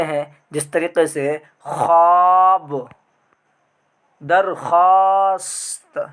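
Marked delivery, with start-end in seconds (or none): 1.7-6.1 ख्वाब said slowly as खाब, silent व; slow careful दरख्वास्त, व not sounded; slow example words. Shown as a demonstration. Teacher reading examples slowly to students. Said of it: "लिखा क्या है".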